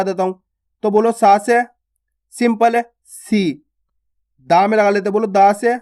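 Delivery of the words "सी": सी said with a short, quick i; सी as one quick syllable, not drawn out; short vowel. Shown as a demonstration. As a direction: keep the vowel of सी brief. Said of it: "जल्दी से उच्चारण कर देते हैं".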